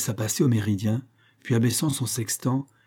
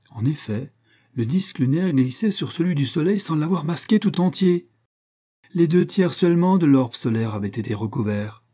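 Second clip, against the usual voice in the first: usual speaking voice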